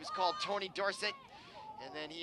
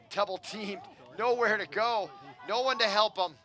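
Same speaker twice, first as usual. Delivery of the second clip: rising, louder play-by-play burst; excited call; 3 semitones higher, 7 dB louder than usual. Real, not acted. Commentator calling the trapped player to viewers, excited and loud. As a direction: sell the danger of the double team.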